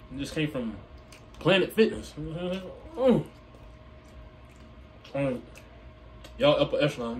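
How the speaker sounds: chewing while talking; speaking between bites; mouth full of chicken. Real, not acted. Seen 2: Bites wing near 0.8s, then talks with grimacing, chewing mouth.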